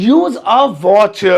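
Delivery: "use a watch" drawn out slowly; slow it right down for the learner; very slow model phrase. Shown as a demonstration. Teacher introduces the phrase, slow and clear. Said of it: "next वाला है use a watch".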